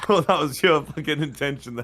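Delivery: excited. Real, not acted. Reacting to friend's move.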